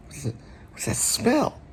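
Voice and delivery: high, hushed reaction to a smell; Richard Dreyfuss. Acted. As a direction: ask it softly, pitch leaping up as if sniffing something. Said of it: "Richard Dreyfuss in Jaws".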